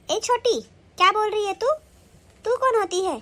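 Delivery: high, loud, angry rebuke. Acted. Elder daughter-in-law snapping at the younger one, high and loud. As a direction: put the upstart in her place.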